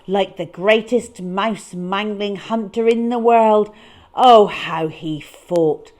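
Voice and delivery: loud, slow, dramatic narration of the fight; dramatic build. A narrator's reading. Storyteller booming as the cat fights. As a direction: roar it out like an epic battle. Cat's wild fight scene.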